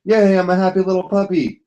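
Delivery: mock puppy voice, chirpy and pleased; happy puppy. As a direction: play a cheerful little puppy. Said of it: "Wag your tail".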